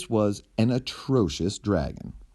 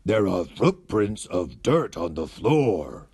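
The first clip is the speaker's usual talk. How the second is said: slow, lightly voiced dragon; dragon noticing. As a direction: point out the clue like a tidy dragon. Dragon points out clue.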